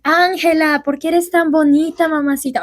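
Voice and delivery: put-on crooning to her in a thick Mexican accent; fake Mexican accent. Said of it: "his fake, like, Mexican accent".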